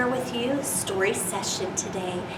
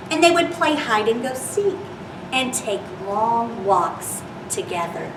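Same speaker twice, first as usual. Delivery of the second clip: gentle storybook reading voice; reading to children. Acted. Reads picture book to children.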